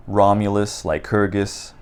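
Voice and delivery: ordinary talk, nothing marked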